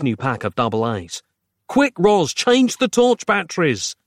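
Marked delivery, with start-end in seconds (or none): 1.7-3.9 high, pressing voice; urgent call. Acted. Narrator rushing Roz with the torch, urgent. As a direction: hurry her with a raised voice. Urging her to hurry.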